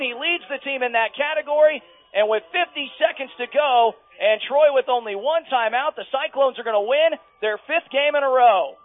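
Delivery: high, loud game-winning call; excited play-by-play. Acted. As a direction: shout the interception call at full excitement.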